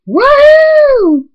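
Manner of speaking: very high, loud squealed whoop; piercing celebratory whoop; whooping cheer. Acted. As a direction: whoop with shrieking delight. Cheers at the kiss.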